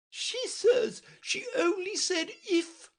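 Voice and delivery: very high, slow, loud mocking; shuddering remark. A narrator's reading. Red Queen repeating Alice with a shudder, high and loud. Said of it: "remarked with a little shudder".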